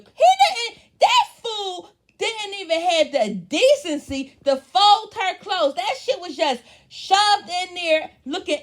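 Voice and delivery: loud, high, indignant ranting; outraged shout. Real, not acted. Recapping the reality show to viewers, a loud, high outraged rant.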